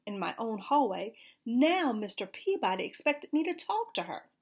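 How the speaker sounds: usual speaking voice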